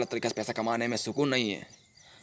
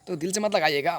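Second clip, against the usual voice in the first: normal talking voice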